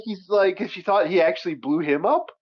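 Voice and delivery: ordinary delivery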